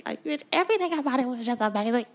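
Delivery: high, loud, fast squeal; excited squeal. Real, not acted. Fan raving to followers about Beyonce's outfits, squealing. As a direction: rave at full pitch.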